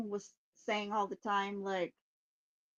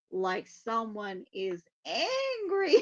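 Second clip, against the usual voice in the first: usual speaking voice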